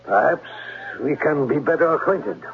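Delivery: put-on German accent. German-accented Klemper lures the governess to the gazebo. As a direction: charm her in a heavy German accent.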